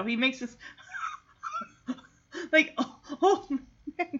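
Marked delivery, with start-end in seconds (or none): 1.9-4.2 chuckled "oh, oh, man"; imitated laugh. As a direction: mimic an old dog's breathy chuckle. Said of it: "kind of laugh".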